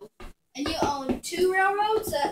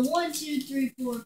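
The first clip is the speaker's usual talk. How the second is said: usual speaking voice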